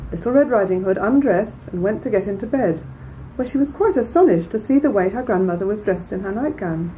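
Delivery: usual speaking voice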